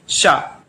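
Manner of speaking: a clear palatal शा, sh sound; palatal sha demo. Shown as a demonstration. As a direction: say शा with tongue touching the palate. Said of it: "क्या बोलेंगे?".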